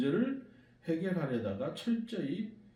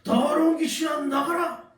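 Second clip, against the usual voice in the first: high, forceful exorcism shout; big loud shout; 11 semitones higher, 8 dB louder than usual. Retold or imitated. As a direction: gather all your strength and order it out. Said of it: "큰 소리로 외칩니다".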